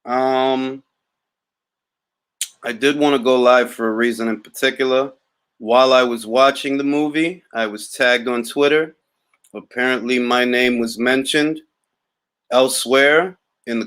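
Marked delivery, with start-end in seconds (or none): none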